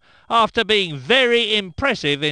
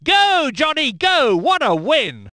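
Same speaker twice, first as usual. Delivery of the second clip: excited home cheer; 6 semitones higher than usual. Acted. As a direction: cheer him over the line. Commentator cheers British win.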